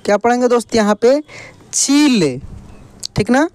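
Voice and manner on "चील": चील with the ī stretched; drawn-out long ī. Shown as a demonstration. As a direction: blend चील, holding the ī long. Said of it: "खींच कर किया जाता है". (चील की ई खींच कर)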